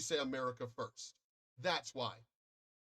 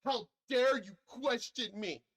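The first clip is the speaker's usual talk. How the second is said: mock outrage, higher, louder and slow; childish angry god; 8 semitones higher, 6 dB louder, slower than usual. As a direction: play God as a spoiled child throwing a fit. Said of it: "That sounds like a child".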